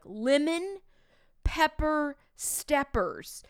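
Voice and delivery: a student slowing it down, drawn out; slowly drawn out. Retold or imitated. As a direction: stretch each word as kids mocking slow. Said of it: "They're like".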